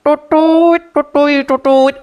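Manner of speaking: lightly hummed flute toots; flute imitation. Shown as a demonstration. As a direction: imitate a bamboo flute, toot toot. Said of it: "seluring kabayan".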